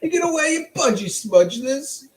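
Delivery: very high, loud Australian that slides into British; bad Australian accent that turns British; failed Australian accent. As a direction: ham up an Aussie asking about swimwear. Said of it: "I can't do Australian".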